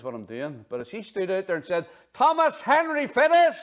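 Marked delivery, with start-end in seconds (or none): 2.1-3.6 much louder, much higher, slow stern mother's yell; angry mum yelling the full name from the door. Retold or imitated. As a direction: bellow every name so he knows he's in trouble. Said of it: "oh, I was in trouble".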